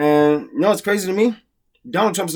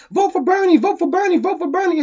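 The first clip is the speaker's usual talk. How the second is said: high-pitched mocking chant of a nagging fan; Antonio; 12 semitones higher than usual. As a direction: pester in a squeaky, repetitive voice. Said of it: "Antonio kept saying".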